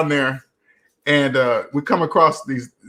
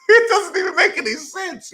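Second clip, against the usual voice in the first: very high, squeaky laugh-talk; laughing in disbelief. Real, not acted. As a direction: squeal it through real laughter. Laughs at his own absurd logic.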